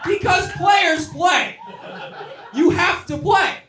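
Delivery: loud, high, drawn-out shout; shouted brag. Real, not acted. Comic bragging to the crowd, loud and high, drawn out. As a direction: stretch it out as a crowd-pumping boast.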